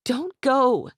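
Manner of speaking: high, loud desperate plea; pleading. A narrator's reading. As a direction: beg him to stay. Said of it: "she pleaded".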